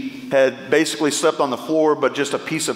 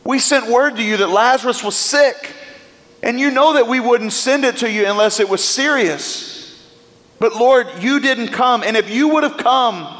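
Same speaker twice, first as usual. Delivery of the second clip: Martha's plea; 7 semitones higher than usual. Acted. Preacher voicing Martha asking Jesus why he waited, pleading. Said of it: "imagine Mary, uh, Martha asking the question".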